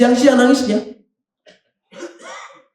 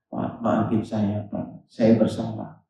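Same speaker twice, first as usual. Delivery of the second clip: humble apology; 4 semitones lower, 9 dB quieter, slower than usual. Retold or imitated. Scholar apologizing to the man.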